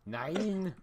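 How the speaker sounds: put-on German accent. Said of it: "I can do a fairly decent German".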